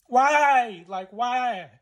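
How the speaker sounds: high, loud cry of why, then easing; heartbroken, wailing question; anguished. Real, not acted. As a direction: plead for an answer through real hurt. Anguished questioning while reliving heartbreak.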